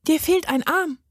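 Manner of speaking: put-on high-pitched, matter-of-fact girl's voice; dry observation. A narrator's reading. Maja inspects the gnome, dry. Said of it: "stellte sie trocken fest".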